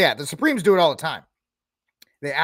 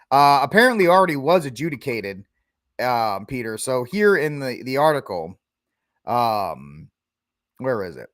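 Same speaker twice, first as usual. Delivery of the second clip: usual speaking voice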